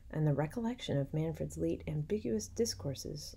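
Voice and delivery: plain reading voice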